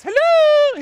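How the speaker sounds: high, loud flirty hello; sing-song lioness hello. Retold or imitated. Lioness lifting her tail, calling high and loud. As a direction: call out high and inviting. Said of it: "she goes".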